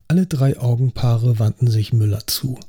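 usual speaking voice

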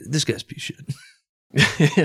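political attack ad. Acted. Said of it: "more and more evil as the sentence went on".

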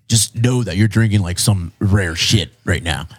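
lightly put-on low, slow voice; put-on bro voice. Quoting the man about rare beer, put-on bro voice. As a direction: drop low and drag it out, imitating him. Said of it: "I like doing that voice".

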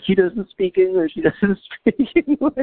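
very high, squeaky imitation of kids; clamoring kids. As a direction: mimic kids crying out, breaking into laughter. Acts out whole room of kids.

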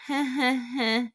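imitated high, uneasy chuckle; mock laughter. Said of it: "everyone's kind of like".

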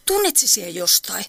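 ordinary talk

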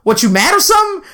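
high-pitched mocking taunt; needling, mock-provoking question; talking shit. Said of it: "so he's talking shit".

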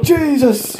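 high squealed outburst of shock; comic high-pitched Jesus; shocked cry. Retold or imitated. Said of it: "He's like".

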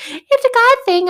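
mocking mom. Daughter mocking her mom's saying in a high squeaky voice. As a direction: parrot her catchphrase in a silly high voice. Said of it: "I used to get so irritated when she's like".

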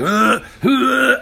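loud, high choking gasps; imitated coughing fit. Trainee retelling why he tapped out, imitated coughing. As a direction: mimic gagging, unable to breathe. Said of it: "I just started coughing".

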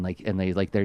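ordinary delivery

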